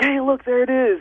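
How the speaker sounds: put-on high, eager kid pointing out a frog; excited little kid. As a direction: chirp it like a thrilled child. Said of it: "ask a little kid".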